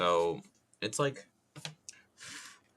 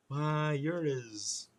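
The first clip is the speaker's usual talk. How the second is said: high, very slow Southern drawl; high cartoon Southern bluster, trailing unfinished; Foghorn Leghorn; 7 semitones higher, much slower than usual. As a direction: start a stretched-out Southern rooster put-down. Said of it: "doing that impression".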